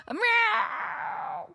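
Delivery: high, squeaky cat meow; playful meow. Pretend. Meowing playfully at her cat Girl Kevin. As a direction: meow back at the cat like a kitten.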